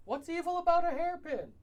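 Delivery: indignant outburst. Acted. Alvin protests in character.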